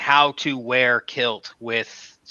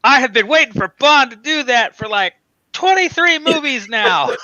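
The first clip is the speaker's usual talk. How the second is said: much higher, louder gushing disbelief; excited fan; 11 semitones higher, 5 dB louder than usual. Retold or imitated. Fan raving to friends about Bond finally pushing the button, high excited gush. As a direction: squeal it like a fan finally rewarded. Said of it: "and I'm like".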